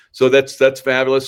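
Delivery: loud, high enthusiasm; excited. Real, not acted. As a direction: gush over it with delight. Enthusing about the product.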